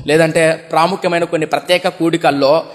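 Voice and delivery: ordinary talk, nothing marked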